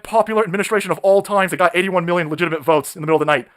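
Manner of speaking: ordinary talk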